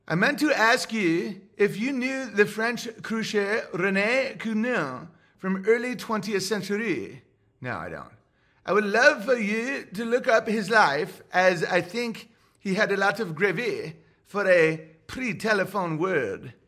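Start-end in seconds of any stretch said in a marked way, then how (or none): 0.1-7.2 French accent, pitched higher, hammed-up for the fan; mock French accent. Reading a French fan's message to the streamer, mock French accent. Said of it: "the French accent". 8.6-16.5 French accent, pitched higher, hammed-up for the fan; mock French accent. Reading a French fan's message to the streamer, mock French accent. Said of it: "the French accent".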